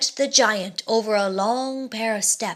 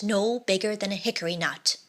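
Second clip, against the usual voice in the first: ordinary delivery, nothing marked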